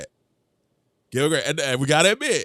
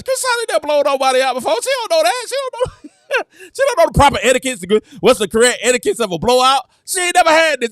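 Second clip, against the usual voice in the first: mock exasperated rant; 7 semitones higher, 5 dB louder than usual. Mocking the coach, rising excitement.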